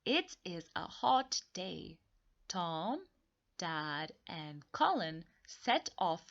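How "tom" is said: ordinary talk, nothing marked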